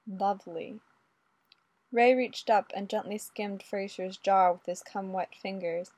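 usual speaking voice

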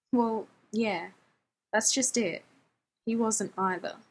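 ordinary delivery

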